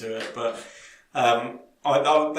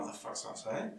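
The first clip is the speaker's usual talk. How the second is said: whispered disbelief; 3 semitones lower, 13 dB quieter, slower than usual. Retold or imitated. Retold shocked double take.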